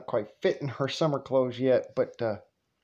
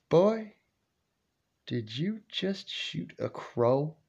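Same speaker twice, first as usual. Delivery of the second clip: high then slow, suspicious grandma; lightly voiced grandma catching him out; 3 semitones higher, 4 dB quieter, slower than usual. Grandma asking the grandson if he shot the crow.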